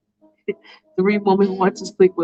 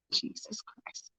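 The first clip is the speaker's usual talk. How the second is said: slow, breathless whisper; whispered aside; 21 dB quieter, slower than usual. Real, not acted. Narrator reacts to Faith's craziness in a slow whisper. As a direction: whisper it under your breath in disbelief.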